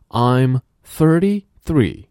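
slow emphatic model; slow loud drill. Shown as a demonstration. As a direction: call it out slowly for the class. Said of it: "drill sentence".

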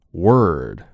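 Word with the tongue curled back for the r; Word said with a strong American r-colored vowel. Shown as a demonstration. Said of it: "卷舌。".

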